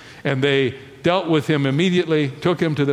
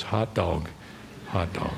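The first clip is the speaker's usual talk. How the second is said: low, soft echo of the outburst; hushed retelling; 8 semitones lower, 10 dB quieter than usual. Retold or imitated. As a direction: drop the voice right down, understated. Storyteller quietly echoes the retold shout.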